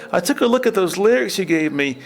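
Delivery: hammed-up Cage impression; comic high-pitched take on the actor; goofy Nicolas Cage. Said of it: "And he goes".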